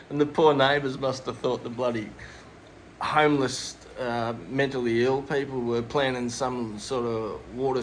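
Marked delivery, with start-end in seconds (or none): none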